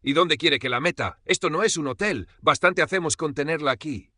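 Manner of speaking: loud, high-pitched indignant outburst; defiant. A narrator's reading. Daughter-in-law defends herself to father-in-law, defiant. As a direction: challenge him with rising exasperation.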